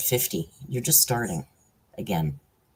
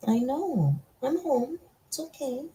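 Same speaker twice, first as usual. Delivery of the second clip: very high, cooing reassurance; soothing pet talk; 12 semitones higher, 3 dB quieter than usual. Real, not acted. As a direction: comfort a whining pet in a tiny high voice.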